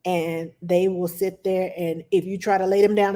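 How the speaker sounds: ordinary talk, nothing marked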